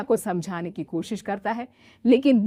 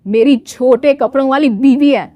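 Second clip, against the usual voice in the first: louder, firm husband; firm defence; 3 semitones higher, 10 dB louder than usual. Acted. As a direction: stand up for your wife. (ज़ोर देकर, पत्नी का बचाव)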